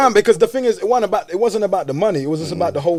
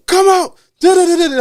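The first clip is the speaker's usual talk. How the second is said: loud, high yelling; retold yell; 13 semitones higher, 8 dB louder than usual. Yelling at the man hiding inside to come out. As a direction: shout it up at the flat, loud and high. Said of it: "literally started yelling".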